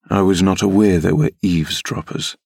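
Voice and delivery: usual speaking voice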